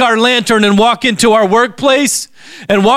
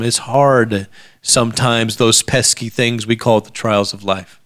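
soft reflective preaching; 10 semitones lower, 3 dB quieter than usual. Acted. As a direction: bring it down low and intimate.

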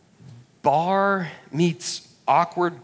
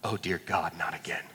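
lower, softer inner groan; dread; 8 semitones lower, 9 dB quieter than usual. Retold or imitated. Younger brother dreading his sister's fame, low and soft. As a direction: mutter it like a doomed little brother. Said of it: "remember thinking in this moment".

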